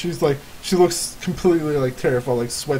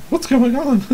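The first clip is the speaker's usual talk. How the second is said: high-pitched worried question; bewildered follower; 10 semitones higher than usual. Acted. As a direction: call it out high, running up from behind. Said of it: "Lisa is kind of, like, running behind you guys".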